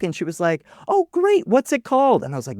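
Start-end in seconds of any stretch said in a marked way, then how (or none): 0.9-2.2 much higher, proud mother voice; high doting mother praising her son; gushing mom. Retold or imitated. Mom asks.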